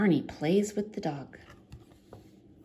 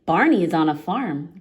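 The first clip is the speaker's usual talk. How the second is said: ordinary delivery, nothing marked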